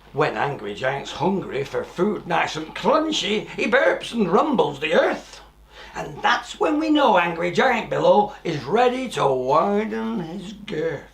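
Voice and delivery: put-on lively rhyming read-aloud for children; a storyteller sharing a rhyme with small children; storybook reading. A narrator's reading. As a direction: read the verse like a bedtime story. Reads rhyming verse of book.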